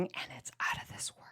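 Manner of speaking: hushed whispered praise; confiding whisper. Acted. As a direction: whisper it like a delicious secret.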